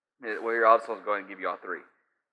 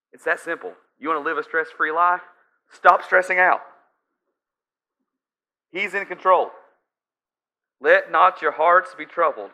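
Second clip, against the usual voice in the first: high, loud urgent preaching; full-force preaching; 6 semitones higher, 9 dB louder than usual. Real, not acted. Pastor pressing the congregation, forceful preaching. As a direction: drive the point home to the congregation.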